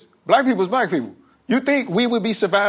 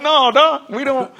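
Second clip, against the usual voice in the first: high, insistent "No, dog"; retold emphatic denial; 8 semitones higher, 4 dB louder than usual. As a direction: push back hard on the idea. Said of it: "I'm like".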